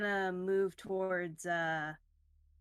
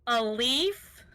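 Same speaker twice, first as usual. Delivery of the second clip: loud, incredulous question; 3 semitones higher, 8 dB louder than usual. Real, not acted. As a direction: throw it back in loud disbelief. Disbelief at question about damage.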